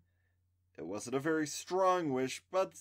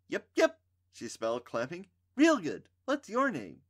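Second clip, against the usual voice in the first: put-on high, bouncy, excited girl; perky girl voice; 7 semitones higher, 5 dB louder than usual. A narrator's reading. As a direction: chirp it out happily. Trisha perky, clapping.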